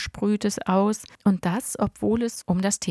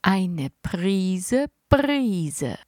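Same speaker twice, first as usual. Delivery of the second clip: drawn-out, very slow secret ingredient name; slow, mysterious; much slower than usual. Acted. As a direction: stretch „Prise Brise“ out like a magic word. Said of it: "sagte Opa".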